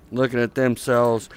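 ordinary talk, nothing marked

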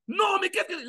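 high, shrill mock-angry mother; mock mother shout. Mother catching her child, mock outraged shout. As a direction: yell like a mom catching the kid.

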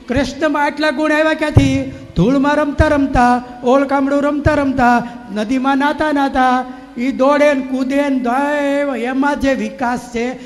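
full-voiced sermon climax; fervent preaching. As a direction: preach it with rising fire. Speaker builds to a passionate climax.

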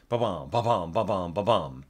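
"ba-bum" sung like a heartbeat pulse; light scat of the piano figure; vocal rhythm. Shown as a demonstration. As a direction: pulse out ba-bum in strict time.

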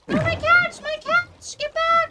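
high, loud panicked yell; panicked shout. Real, not acted. Player yelling after his cats in the water, panicked shriek. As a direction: scream for the cats in real panic.

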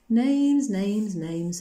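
lightly voiced lost ghost, opening high and falling; far away and lost. A narrator's reading. Lost voice answers Coraline's question, mildly far away. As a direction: call it out like a soul far away. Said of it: "said another voice all far away and lost".

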